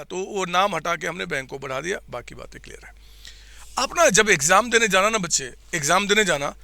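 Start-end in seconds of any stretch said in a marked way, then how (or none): none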